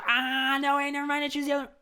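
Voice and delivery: very high, frantic take-back; panicked squeak. Retold or imitated. Backing out of the deal with the witch, high panicked squeal.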